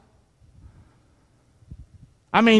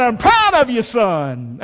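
emphatic fatherly pride; slower than usual. Shown as a demonstration. Preacher showing dads what to tell sons, emphatic pride. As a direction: declare it firmly, then settle on the last word. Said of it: "you need to say to your son".

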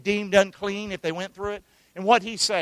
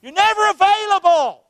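high, loud cry of complaint; acted angry accusation; 12 semitones higher, 7 dB louder than usual. Family complaining to an unavailable father, angry shout.

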